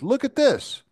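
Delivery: high-pitched excited call; retold excited "look here", pitch leaping up; excited urging. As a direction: imitate someone eagerly showing a discovery. Retells partner's excited call.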